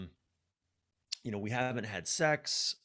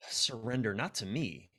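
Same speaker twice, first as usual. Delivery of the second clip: usual speaking voice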